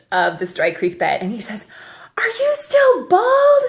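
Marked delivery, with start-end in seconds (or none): 2.2-3.6 high, slow, loud imitation of a boy; child calling out. Five-year-old at the creek bed asking his mother, calling out. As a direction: holler it like a kid far off.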